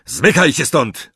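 high, sharp order; bellowed. A narrator's reading. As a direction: bellow at them to get moving. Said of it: "huknął na mugolaków".

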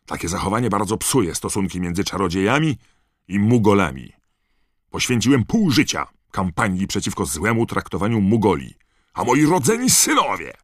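loud, high indignant tirade; angry shout. A narrator's reading. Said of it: "krzyknął pan Weasley".

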